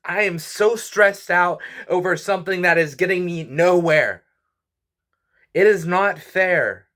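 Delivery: high-pitched, exasperated venting; real frustration. Real, not acted. Vlogger venting about critics, high exasperated complaint. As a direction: let the frustration spill over, slowing on "not fair". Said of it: "I'm just frustrated".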